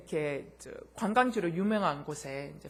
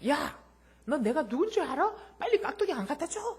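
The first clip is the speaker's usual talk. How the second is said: angry customer; 8 semitones higher than usual. Retold or imitated. Arrogant customer quarrel.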